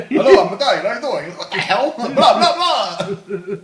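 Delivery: put-on laughing through a failed Italian accent that drifts toward Dracula; bad Italian accent. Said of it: "when I do an Italian, it sounds like Dracula".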